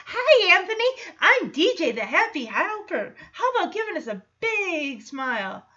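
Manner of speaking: high, bright mock-cheerful greeting to a kid; playing the sugary happy helper; chirpy helper voice. Teen at photo studio coaxing a child, hammed-up chirpy voice.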